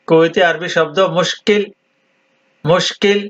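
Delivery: ordinary delivery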